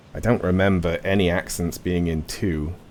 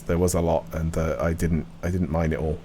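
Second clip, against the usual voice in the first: ordinary delivery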